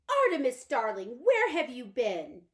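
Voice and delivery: put-on calling out to her boy in the dark; fluttery invalid mother. Mother greets her son.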